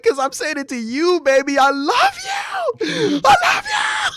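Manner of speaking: ecstatic high-pitched love shouts; sweet nothings. Retold or imitated. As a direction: shriek I love you over and over. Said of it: "He's like".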